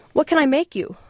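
Angel's voice. Acted. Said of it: "Angel asked".

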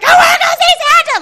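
very high mock tantrum shriek; crying and screaming. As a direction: wail like a child throwing a fit. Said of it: "Like, crying and screaming".

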